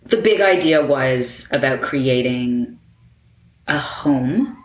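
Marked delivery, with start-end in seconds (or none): 3.7-4.6 "home" set apart by a pause; emphasis on HOME. Real, not acted. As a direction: land deliberately on "home" after a beat. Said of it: "I have to emphasize the word home".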